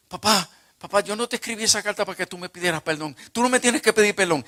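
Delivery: loud, high, rushed pleading with father; urgent protest. Retold or imitated. Son protesting on the phone that father needn't apologise, urgent and loud.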